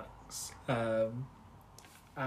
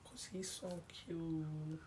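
soft, slow, drawn-out coo; gushing softly; 3 semitones higher, 13 dB quieter, slower than usual. Real, not acted. Friend gushing over favourite character, softly. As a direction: melt over how adorable he is.

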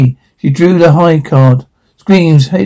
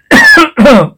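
cough; 10 semitones higher, 4 dB louder than usual. Acted. Cough mid-line in scene.

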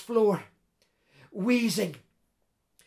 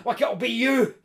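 loud, vicious taunt rising to a shout; snarled threat to a losing rival; snarling; 3 semitones higher, 7 dB louder than usual. A narrator's reading. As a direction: gloat fiercely, building in force. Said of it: "the intruder was snarling".

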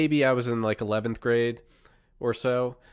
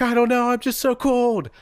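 sister shivering; 11 semitones higher, 5 dB louder than usual. Retold or imitated. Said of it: "And she's like".

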